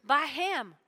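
call and response. Shown as a demonstration. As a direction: sing it out so the congregation echoes it. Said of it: "Everybody say".

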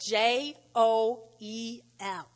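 slow letters J O E L; spelled out slowly. Shown as a demonstration. Pastor spelling Joel for the congregation, slow and clear. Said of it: "I'm gonna spell it Joel".

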